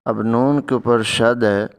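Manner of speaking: ordinary talk, nothing marked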